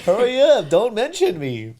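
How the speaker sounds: loud laughing tease; loud teasing. Real, not acted. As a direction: tease him loudly.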